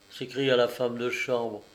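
usual speaking voice

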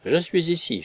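calling out high across the dark. A narrator's reading. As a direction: call out to be heard from the shed. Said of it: "j'élevai la voix à mon tour".

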